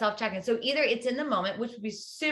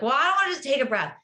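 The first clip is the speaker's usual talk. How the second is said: louder, higher, quick imitated protest; mock reluctance; 4 semitones higher, 5 dB louder, faster than usual. Coach mimicking a client who finds breathing cheesy, mock reluctance. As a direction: push back like a resistant client.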